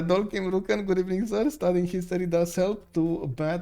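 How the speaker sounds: ordinary delivery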